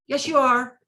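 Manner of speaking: retold teen's heated insistence; insistent retort. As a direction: hammer the point back. Teen argument with speaker.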